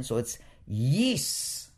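'Yis' said slowly as one closed syllable; Yis, closed syllable. Shown as a demonstration. Teacher modeling Yis for the class, slow and careful. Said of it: "needs to be closed".

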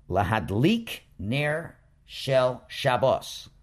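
'Lahadlik ner shel Shabbos' said slowly, every word apart; slow careful reading. Shown as a demonstration. Teacher reading the blessing's close for students, slow. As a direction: read the phrase slowly, end-stressed. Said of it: "So we would pronounce it".

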